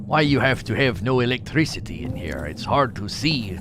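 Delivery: lightly put-on Russian accent, broken English; hammed-up Russian accent for laughs; mock Russian accent. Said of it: "a Russian accent that I did".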